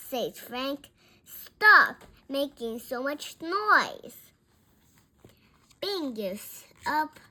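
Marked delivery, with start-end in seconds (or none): 1.2-4.2 much louder, emphatic telling-off; exasperated loud command; loud scolding. A narrator's reading. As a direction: order the noise stopped. Frank orders the noise to stop.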